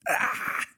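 slow breathy rasped ah; raspy growl. Shown as a demonstration. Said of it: "people who sing intentionally like that".

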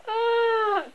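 very high sustained scream; acted high shriek. A narrator's reading. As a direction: hold a high wailing scream.

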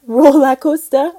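laughing try at "Roller coaster" in a Geordie accent; slow, unsure Geordie "Roller coaster"; a giggly attempt at a Geordie accent; failed Geordie attempt. Shown as a demonstration. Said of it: "Accent's terrible".

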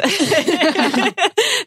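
bright high giggle, rising; a giggle at being flattered; high laugh. Real, not acted. Laughs at her own British voice.

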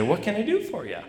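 a distant, professional smile in the voice; cheery business voice. Retold or imitated. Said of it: "to her business voice".

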